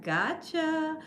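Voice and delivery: soft, drawn-out teasing lilt; quiet mock taunt, rising at the end; imitating sneaky gotcha. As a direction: tease softly, imitating the lady. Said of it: "she did like this".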